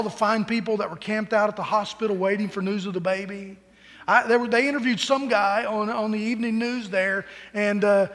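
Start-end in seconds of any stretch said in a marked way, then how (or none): none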